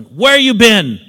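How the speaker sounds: loud, high furious yell at the door; angry shout. Retold or imitated. Friend shouting at late borrower on the doorstep, furious. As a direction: bark it out, fed up and furious. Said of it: "Shouting and mad".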